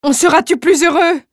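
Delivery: wife's anguished plea to her husband; cried in despair. Acted. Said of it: "cria-t-elle avec désespoir".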